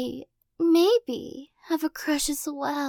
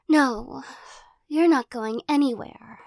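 teasing possessive captor. Acted. Captor pinning the listener against a wall, playful menace. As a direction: toy with them like a cat with a mouse.

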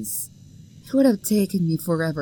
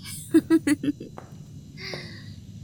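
short, playful stalker giggle; teasing little laugh; 6 semitones higher than usual. Acted. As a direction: giggle to yourself while hunting. Hidden prey, stalker teasing.

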